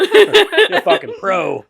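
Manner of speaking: high, slow, laughing praise. Real, not acted. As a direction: squeal it out through laughter.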